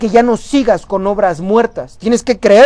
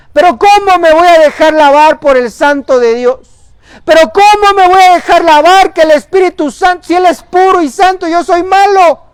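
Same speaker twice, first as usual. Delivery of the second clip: much higher, louder, incredulous protest; Pedro protesting; 8 semitones higher, 6 dB louder than usual. Acted. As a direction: play Pedro refusing, aghast and insistent. Pedro resists Jesus washing feet. (Pedro protestando, agudo y fuerte, incrédulo)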